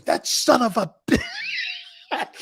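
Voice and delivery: high, drawn-out quote collapsing into laughter; mock cursing that breaks into a laugh; retold outburst cracking up. Aunt cursing at the German remark, cracking up.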